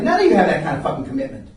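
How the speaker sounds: usual speaking voice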